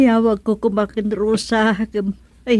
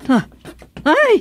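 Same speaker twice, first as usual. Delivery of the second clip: high sharp gasp of shock; high surprised yelp; startled cry; 10 semitones higher, 4 dB louder than usual. Acted. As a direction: jump at the sight. Sees termites, startled.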